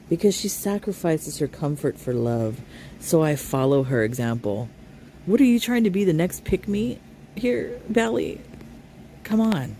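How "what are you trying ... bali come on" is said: mocking scorn. Real, not acted. Mocking the vlogger's behaviour.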